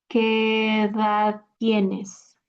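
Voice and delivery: "¿Qué edad tienes?" drawn out slowly; careful slow teaching voice; slow, clear breakdown. Shown as a demonstration. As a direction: stretch it for learners. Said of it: "We'll break it down afterwards".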